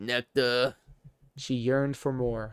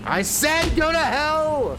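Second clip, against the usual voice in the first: much higher, louder, slow defiant yell; defiant shout; 6 dB louder than usual. Acted. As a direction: scream it at him, axe in hand. Daughter defies father with axe.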